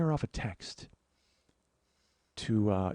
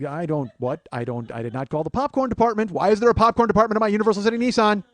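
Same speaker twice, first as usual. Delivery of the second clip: loud, fast, rising exasperation; exasperated; 8 semitones higher, 10 dB louder than usual. Retold or imitated. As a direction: rant in disbelief. Exasperated retold complaint.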